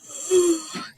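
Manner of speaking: high, drawn pretend yawn; mock yawn. Teacher shows students what tired is, mock yawn. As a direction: act out a sleepy yawn. Acts out tired family yawning.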